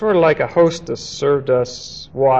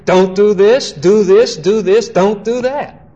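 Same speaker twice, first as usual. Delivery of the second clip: higher, punchy list of commands; rattled-off rules; 3 semitones higher than usual. Acted. Teacher mimics the law's many strictures, punchy chanted commands. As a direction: hammer out each do and don't like a rulebook.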